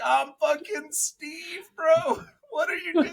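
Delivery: high, slow, laughing disbelief; high laughing disbelief at his buddy; pleading with a friend who blew his cover; exasperated plea. Retold or imitated.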